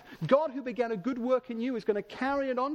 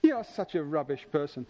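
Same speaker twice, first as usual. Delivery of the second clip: low, dismissive put-on voice; hammed-up contempt, dropped low; mock disdain; 9 semitones lower than usual. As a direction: play a scornful judge writing someone off. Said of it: "So Jesus isn't here tonight thinking".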